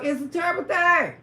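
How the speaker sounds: ordinary talk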